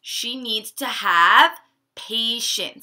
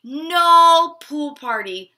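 dismayed cry; 4 semitones higher, 7 dB louder, slower than usual. Acted. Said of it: "she cried".

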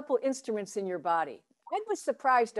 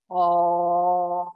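louder held oh resonating from the belly; hara voice vowel; 4 semitones lower, 7 dB louder than usual. Shown as a demonstration. Teacher demonstrating a hara vowel for students, held belly-resonant oh. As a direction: sing out oh as if your belly had a mouth. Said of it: "Can you hear the difference?".

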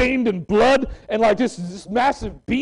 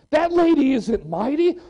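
ordinary talk

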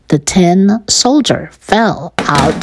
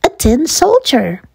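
put-on high-pitched boy's voice; high, eager child voice; excited boy; 8 semitones higher than usual. A narrator's reading. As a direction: show off a find like a boy. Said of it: "The two boys ran up".

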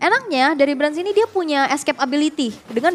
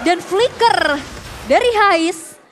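excited; 4 semitones higher, much slower than usual. Real, not acted. Caster hypes a clutch flicker.

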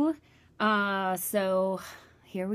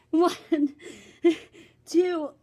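laughing through push ups; 4 semitones higher, 3 dB louder than usual. Real, not acted.

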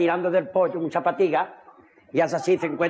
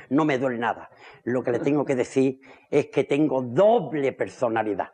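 low voice; 8 semitones lower than usual. Acted. Patient speaks in other voice.

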